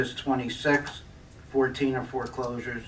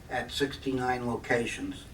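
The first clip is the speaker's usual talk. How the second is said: normal talking voice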